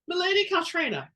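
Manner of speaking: high, loud call across the table for attention; commanding call. Acted. Princess addressing Caltrena before all guests, loud and commanding. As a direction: summon the room's attention with her name. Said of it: "speaking in a voice loud enough to command attention".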